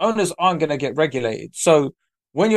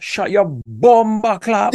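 slow, higher imitation of a Jamaican woman's scolding; Jamaican accent; 6 semitones higher, much slower than usual. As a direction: drawl it out like a Jamaican auntie telling someone off. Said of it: "it was a Jamaican woman".

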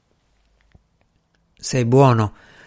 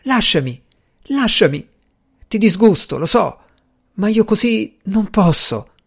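high, agitated plea with restrained volume; sobbing cry, understated; 6 semitones higher than usual. A narrator's reading. Woman struggling to be let go, understated sobbing cry. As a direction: push him away in a mildly acted, tearless outcry. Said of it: "mi respingeva singhiozzando senza lacrime e gridando".

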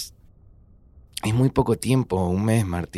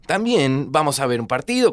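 ordinary talk, nothing marked

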